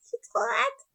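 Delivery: très aiguë, voix de petite fille; s'exclamer avec envie; impatiente. Real, not acted. Impatience joyeuse. (impatience aiguë et enjouée)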